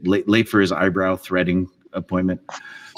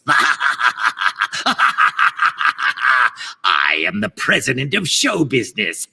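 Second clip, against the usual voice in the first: grand villain with maniacal laughs, climbing to a shriek; megalomaniacal madman. Acted. Mad villain boasting of his plan for show business, megalomaniacal and cackling. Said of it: "standard issue megalomaniacal madman who wants to take over the world".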